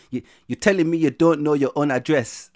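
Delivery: put-on loud, high African-accented indignation. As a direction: demand it in a big African accent. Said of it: "Caribbean or African".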